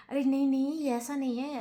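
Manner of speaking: high, loud flustered protest; flustered denial. Retold or imitated. Rajat hastily denying to crying Chahat, flustered. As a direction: rush to deny it, caught out.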